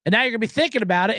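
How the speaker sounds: normal talking voice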